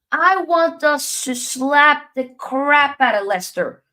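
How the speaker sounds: loud, high and slow, hyped; fired up. Real, not acted. Streamer hyping fans about smashing Leicester, loud and fired up. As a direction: bang the table for the win.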